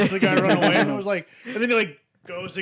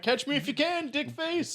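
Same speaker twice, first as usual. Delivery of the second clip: high, softened teasing call; mock taunting; 8 semitones higher, 5 dB quieter than usual. Naked streaker taunting pursuing cops, mock taunt. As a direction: taunt like a runaway, as if from afar.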